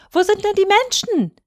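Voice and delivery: put-on high-pitched childish question, called out; boy calling up. A narrator's reading. Boy questions the rooster on the tower, calling up. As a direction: call up high like a small boy. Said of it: "rief der kleine Häwelmann hinauf".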